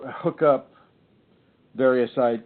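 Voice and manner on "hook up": ordinary talk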